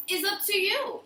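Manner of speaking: high bright playful "up to you"; emphatic high lift. Real, not acted. Emphatic punchline rising.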